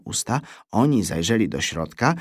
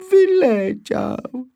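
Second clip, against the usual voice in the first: bardzo wysoki, rozwleczony dziecięcy lament; przeciągnij płaczliwie, jak chlipiące dziecko; chlipanie; 5 dB louder, much slower than usual. A narrator's reading. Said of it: "chlipnąłem". (po polsku: żałosne, powolne chlipanie)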